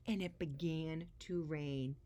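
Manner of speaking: ordinary delivery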